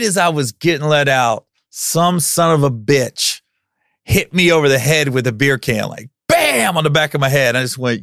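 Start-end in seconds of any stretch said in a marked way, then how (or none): 6.3-6.8 high, punched "bam"; impact word. Retold or imitated. Storyteller acting out a beer can hitting his head, punchy.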